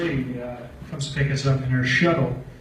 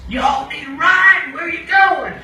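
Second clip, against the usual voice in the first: much higher, louder, slow call; shuttle driver; 13 semitones higher, 8 dB louder, slower than usual. Retold or imitated. Shuttle driver calling to the drunk campers, high and loud. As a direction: holler it out like a wild driver.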